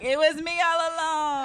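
high, loud triumphant unmasking; mock villain reveal. A scammer at the inauguration gleefully revealing herself, loud and high. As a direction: gloat like a scammer dropping her disguise. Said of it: "Joanne the scammer ... like".

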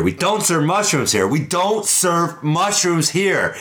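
building up to a blowup; rising bartender anger. Acted.

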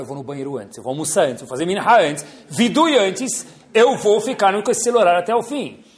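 determined vow. Retold or imitated. Caller vowing to stay on line.

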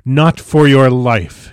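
loud, slow, emphatic command; urgent, commanding outburst; forceful warning. A narrator's reading. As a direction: forbid it with fierce force, each word drawn out. Hurls him back from her.

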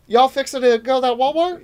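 loud, high mock country accent. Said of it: "it's a hick accent".

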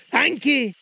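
put-on high loud nervous old man; frightened Scrooge. Scrooge answering the ghost, afraid. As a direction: blurt it out in fear.

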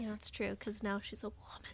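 hushed dramatic whisper. Pretend. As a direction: breathe it out in a whisper.

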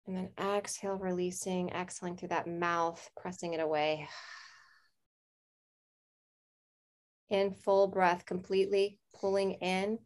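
guided meditation. Acted. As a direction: ease them into calm breath. Leads a breathing meditation.

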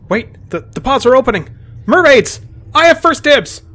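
high, loud call to a companion; excited yell. Acted. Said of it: "I yell out to Cassander".

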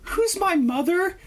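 put-on high, slow child's question; eerie little girl. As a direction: ask it slowly in a high child voice. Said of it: "she's like".

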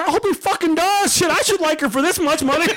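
high, indignant complaint. Real, not acted. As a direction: squeal it out in disbelief. Indignant rant about cost.

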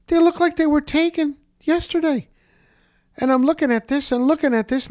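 excited. Real, not acted. Excited at pristine old photos.

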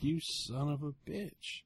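soft, slow grumbled curse under the breath; muttered curse. Retold or imitated. Seller cursing the buyer who demanded a discount, muttered. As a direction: mutter it in disgust.

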